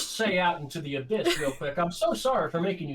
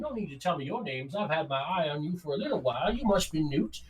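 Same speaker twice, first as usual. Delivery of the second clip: higher, swooping folksy voice; genial rock gnome; 7 semitones higher than usual. Acted. Mysterious uncle Tacitus meeting the party, folksy and genial. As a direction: greet them like an eccentric uncle.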